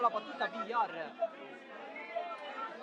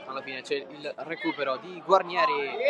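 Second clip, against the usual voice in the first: ordinary talk, nothing marked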